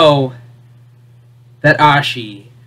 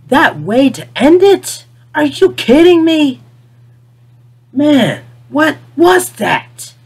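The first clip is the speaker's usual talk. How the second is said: higher, voice climbing in disbelief; can't believe they ended it that way; incredulous outburst; 7 semitones higher, slower than usual. Real, not acted. Ranting to viewers about the finale, incredulous and rising.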